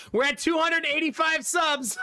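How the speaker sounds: hype-train milestone cheer to chat; excited announcement. Real, not acted. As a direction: shout the count out proudly. Announcing sub count to chat.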